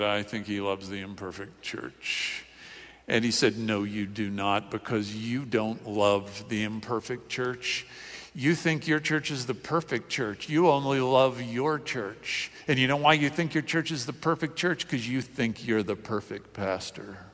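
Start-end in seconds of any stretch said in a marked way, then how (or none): 0.0-2.4 low, very soft reply; sheepish answer. Retold or imitated. Young church planter answering Schaeffer, sheepish and hushed. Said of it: "he stammered a little bit". 3.6-17.2 quiet rebuke. Retold or imitated. Schaeffer telling a young pastor his fault, quiet rebuke.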